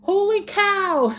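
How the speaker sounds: a high, slow "Holy cow" after a puzzled "huh"; Kathy's confusion. Retold or imitated. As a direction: echo her bewildered reaction. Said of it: "And Kathy's like".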